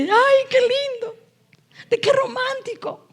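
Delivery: high, slow mock-dreamy sigh; mock lovestruck gushing; mock swoon. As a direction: parody your own starry-eyed self. Mocking her own past naivety.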